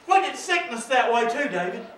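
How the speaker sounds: ordinary delivery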